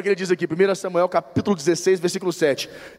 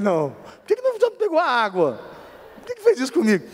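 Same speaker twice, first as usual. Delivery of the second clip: higher, half-joking outrage; mock indignant; 7 semitones higher than usual. Husband joking to the church, mock indignant protest. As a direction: play up being wronged over the water. Mock complaint about wife.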